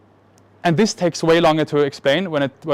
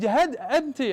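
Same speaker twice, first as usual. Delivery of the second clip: higher-pitched Italian doctor's broken English; broken English, Italian accent; 8 semitones higher, 6 dB quieter than usual. Retold or imitated. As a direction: imitate an Italian doctor short of English words. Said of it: "they don't speak so good English".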